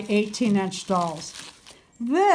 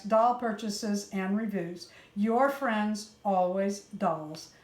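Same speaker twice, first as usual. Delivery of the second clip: softer, even read-aloud voice; reading a letter aloud; 6 dB quieter than usual. Real, not acted. Vlogger reads gift senders' note to viewers, softly read aloud.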